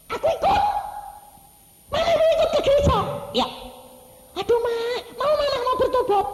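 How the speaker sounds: very high, loud amazed questions; astonished child. Retold or imitated. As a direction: squeal it in disbelief. Anak kaget ibunya mau ikut. (anak terheran-heran, suara sangat tinggi dan keras)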